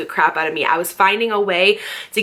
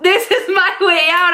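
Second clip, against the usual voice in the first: much higher, louder, with a delighted laugh; gleeful as she sees her escape; 11 semitones higher, 4 dB louder than usual. Retold or imitated. Realizing the fight frees her from the boyfriend, gleeful laugh. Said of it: "I was like".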